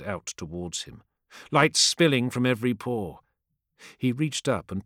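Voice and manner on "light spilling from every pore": usual speaking voice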